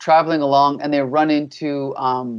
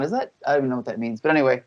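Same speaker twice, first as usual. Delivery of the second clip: usual speaking voice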